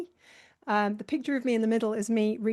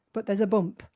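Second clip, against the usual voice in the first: ordinary delivery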